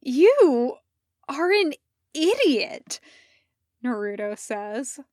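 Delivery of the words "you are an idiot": high, loud disbelief; incredulous. Acted. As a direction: spit it out in total disbelief. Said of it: "less cheerful and more incredulous".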